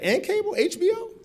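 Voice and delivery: very high, loud squeal of delight; amazed squeal. Retold or imitated. Freshman discovering cable TV, very high delighted squeal. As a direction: squeal like a kid at Christmas.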